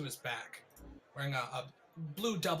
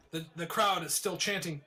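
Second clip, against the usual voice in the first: ordinary talk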